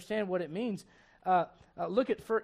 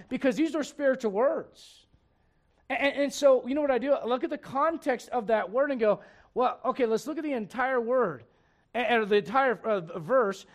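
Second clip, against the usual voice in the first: louder, higher sermon push; fervent preaching; 5 semitones higher, 6 dB louder than usual. Acted. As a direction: drive the point home to the congregation. Preaching fervently, acting out reading.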